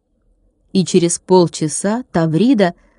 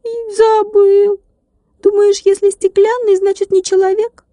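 a little glass man feeling slighted; hurt tiny glass man; 13 semitones higher than usual. A narrator's reading. Tilka hurt. (уязвлённый стеклянный человечек, высоко и медленно)

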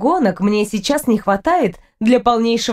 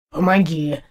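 low, grudging cat voice; grumbling low creature voice; grumpy cat; 6 semitones lower than usual. A narrator's reading. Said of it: "ворчливо отозвался Портур".

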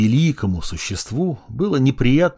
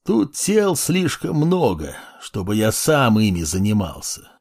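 lazy yawning. Acted. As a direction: speak through a lazy yawn. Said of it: "лениво зевая".

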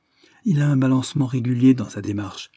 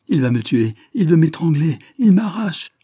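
high, loud wail for help; 7 semitones higher, 6 dB louder than usual. A narrator's reading. Chad caught by monsieur Lidney, wailing. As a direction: wail louder and higher with each plea. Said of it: "gémissait Chad".

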